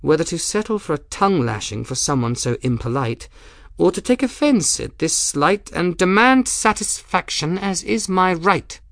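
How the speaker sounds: icy, precise formality rising in pitch; mounting, precisely worded indignation; cold and precise. A narrator's reading. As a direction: deliver the threat with cold hauteur. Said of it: "voice came out cold and precise".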